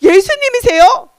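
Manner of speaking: stammering, high-pitched amazement; loud, stuttered disbelief; stutter, then cry out in shock; very startled. Acted. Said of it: "깜짝 놀랐어요".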